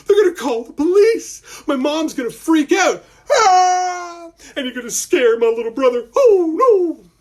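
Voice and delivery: high, loud wailing worry; panicked boy. Acted. Malcolm wailing up at the dragon about his family. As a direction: fret out loud near tears.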